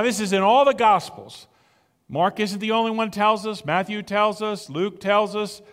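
ordinary talk, nothing marked

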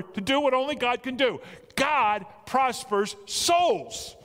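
rising preaching. Acted. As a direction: preach it, building to the peak. Preacher building to climax.

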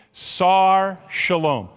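"Sar Shalom" very slow, SAR stressed, falling; slow teaching demo. Shown as a demonstration. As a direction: lead the congregation through "Sar Shalom". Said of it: "Can you say it with me?".

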